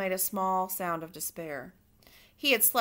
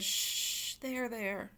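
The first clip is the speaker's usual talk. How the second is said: soft, hushed comforting; calm her tears gently; soft, soothing shushing; 7 dB quieter, much slower than usual. Acted. Louise comforting weeping Cook.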